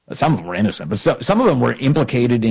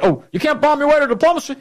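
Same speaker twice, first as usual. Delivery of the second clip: high, sing-song mock quote; mocking Obama; 12 semitones higher, faster than usual. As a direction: parody him in a higher, singing tone. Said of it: "he's saying things like".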